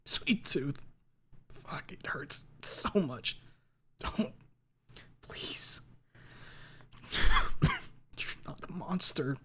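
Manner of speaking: slow, faint, breathless whisper; fading life in each word; weak whisper. A narrator's reading. Dying narrator whispering to Lucy.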